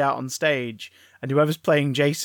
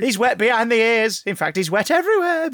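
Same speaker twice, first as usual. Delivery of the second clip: shrill camp pantomime dame; 6 semitones higher, 4 dB louder than usual. Acted. Mrs Voorhees as panto dame.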